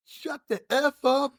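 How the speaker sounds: high-pitched mock yell; hammed-up irate comeback; angry retort. Retold shouting match.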